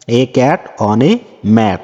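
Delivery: very slow, careful English reading; slow read-aloud. Shown as a demonstration. Teacher reading the textbook instruction slowly to pupils. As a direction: spell it out slowly for the class.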